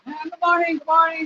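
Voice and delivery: high, cheerful imitated locals greeting; friendly greeting. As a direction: mimic smiling neighbours saying good morning. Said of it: "they just smile".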